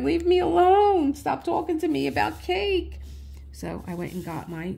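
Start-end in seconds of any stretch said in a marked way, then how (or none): 0.0-3.0 much higher and louder exasperated cry; yelled plea. Retold or imitated. She yells at her brain to stop offering cake, much louder. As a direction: shout it at the nagging voice. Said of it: "I'm like".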